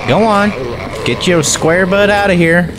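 normal talking voice

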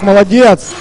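ordinary talk

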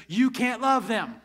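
usual speaking voice

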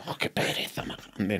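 breathy whispered boredom; a whispered, weary groan of boredom; mock bored sigh. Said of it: "que dices".

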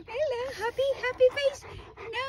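sing-song high greeting; playful pet talk. Real, not acted. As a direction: coax a pet in a high, light voice. Coaxing dog to greet followers.

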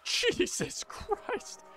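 real whispered exclamation, drawn out; stunned whisper. Real, not acted. Gamer gunned down by a firing line, stunned slow whisper. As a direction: whisper it slowly in shock. Startled by sudden enemy fire.

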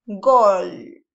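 a loud "Gol" yelled like at a match; shouted goal. Shown as a demonstration. Teacher acting out a fan celebrating a goal, loud. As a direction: bellow it like a fan in the stands. Exclamation example.

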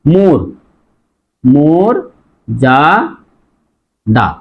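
"মোর যা দা" with pauses between syllables; rounded o in মোর, then separate "ja", "da". Shown as a demonstration. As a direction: split model "mor, ja, da". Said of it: "উচ্চারণ হবে".

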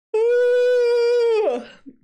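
long, high, loud exhaled whoo; long recovering sigh. Real, not acted. Calming down after crying with laughter, long loud sigh.

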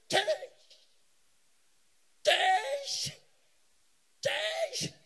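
high, piercing mock rallying cry; imitated high-pitched street shout; mimicked crowd shout. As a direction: shout it high, like people jeering on sight. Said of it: "they shout".

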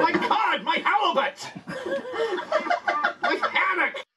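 high, loud comic exclamations; loud joking shouts. Pretend. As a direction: shout each fish name for laughs. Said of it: "could you say, "Hake" instead?".

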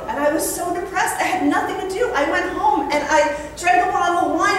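normal talking voice

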